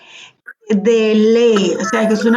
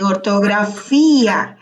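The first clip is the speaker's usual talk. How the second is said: ortografía said slowly, leaning on FÍ; stress on the accented I; 2 semitones lower, slower than usual. Shown as a demonstration. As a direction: draw out the í of ortografía. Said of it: "tiene acento en la I". (ortografía marcando despacio la í)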